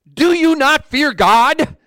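high, loud, drawn-out challenge; yelling rebuke. Acted. Thief rebuking the other thief, yelling. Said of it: "He's literally yelling at the other thief".

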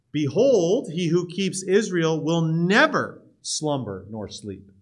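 loud "Behold", slowing with weight on "never"; heightened emphasis. Shown as a demonstration. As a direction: lean hard on "Behold" and "never". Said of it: "it's kind of a heightening, another form of emphasis".

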